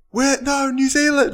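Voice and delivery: high, loud heckle; imitated heckler. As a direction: shout it out from the audience. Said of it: "my friend is the fucking heckler".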